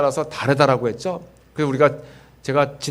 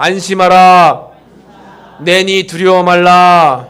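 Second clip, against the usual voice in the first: slow, much louder proclaiming; congregation call; 3 semitones higher, 9 dB louder, much slower than usual. Shown as a demonstration. Pastor leads congregation in repeating the verse, loud and slow. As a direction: lead the crowd in a loud slow recitation. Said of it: "한번 따라해 보겠습니다".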